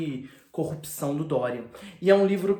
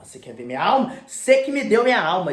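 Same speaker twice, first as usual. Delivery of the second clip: louder, rising accusation; angry outburst; 4 semitones higher, 9 dB louder than usual. Retold or imitated. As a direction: throw it back at him loudly. Dorian lashing out.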